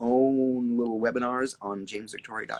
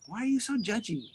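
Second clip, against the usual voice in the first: mock scolding; 13 semitones higher than usual.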